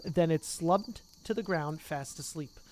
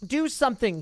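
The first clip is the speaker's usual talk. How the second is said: desperate plea; 5 semitones higher, 4 dB louder than usual. Acted. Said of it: "he said desperately".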